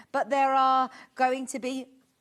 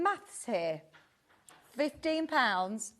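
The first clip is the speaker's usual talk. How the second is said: normal talking voice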